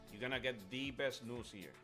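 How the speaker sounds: ordinary delivery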